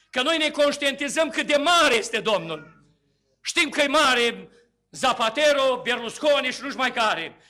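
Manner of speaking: loud, driving sermon at full force; fervent preaching. Acted. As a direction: preach it to the congregation, building.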